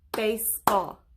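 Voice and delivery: "Baseball" in two separated beats; syllable by syllable. Shown as a demonstration. Teacher clapping with pupils, "Baseball" broken into two beats. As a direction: split "Baseball" after the first syllable, as if clapping each part. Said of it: "Clap it and, and say it with me".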